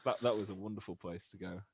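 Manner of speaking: usual speaking voice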